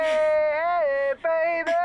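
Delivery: high, loud cheery hello; bright loud on-air hello to the presenter; excited greeting. Acted. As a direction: burst in like a showman greeting his fans.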